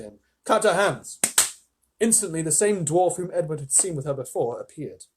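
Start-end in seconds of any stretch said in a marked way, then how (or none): none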